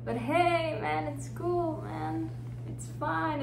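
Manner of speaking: string it out lightly, pretending not to care; feigned nonchalance. Woman pretends to accept it, strained false calm.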